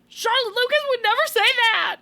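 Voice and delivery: high, loud outraged yell at the screen; retold yelling. Podcast host re-enacts heckling the show, indignant yelling. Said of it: "I kept yelling things like".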